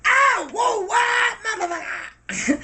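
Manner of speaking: high startled shout; startled plane. Retold or imitated. The plane reacting to bounced pygmies, high startled shout. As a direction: yelp like a surprised plane. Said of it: "the plane be like".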